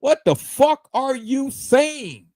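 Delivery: high, slow incredulous outburst; mock an outraged retort, high; retold outrage. Quoting what he told a rival streamer, a high outraged retort. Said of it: "I said".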